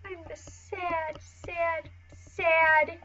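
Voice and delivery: high, slow mopey whine turning cheery; high whiny sad character voice. Pretend. Artist voicing her sad drawing, high mopey whine. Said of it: "voicing sad character, cheering".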